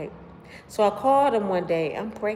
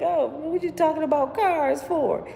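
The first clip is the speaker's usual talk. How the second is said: puzzled retort; 10 semitones higher than usual. Retold or imitated. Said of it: "I'm like".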